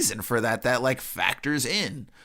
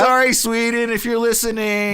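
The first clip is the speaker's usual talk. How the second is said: playful mock apology, higher and louder; 9 semitones higher, 5 dB louder than usual. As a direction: apologize to Sweden with a wink.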